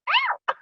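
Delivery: very high, comic "ow"; mock yelp of pain. Performer joking about relentless choreography, mock pained yelp. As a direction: squeal as if poked, played for laughs.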